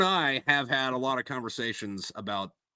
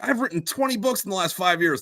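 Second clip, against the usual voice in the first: higher-pitched mock author brag; imitating boastful authors; 8 semitones higher than usual. Author mimicking people in the authors group, mock boast. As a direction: lift the pitch to mimic bragging writers. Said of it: "and they're like".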